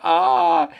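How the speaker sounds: loud, very high drawn-out wail; acted scream. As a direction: wail while hurtling through the air. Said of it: "y Floyd grita". (alarido fingido, fuerte y muy agudo)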